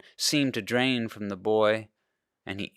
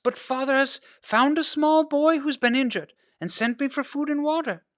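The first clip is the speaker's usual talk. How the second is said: put-on breathless girl.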